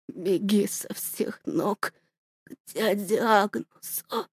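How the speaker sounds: father gasping last orders to daughter; dying whisper. Acted. (тихо, шёпотом, умирая)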